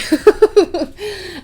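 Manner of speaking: a burst of high laughter; embarrassed laugh. Real, not acted. Vlogger laughing at her own overshare, high laugh. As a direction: laugh it off, a little mortified. Laughing about surgery aftermath.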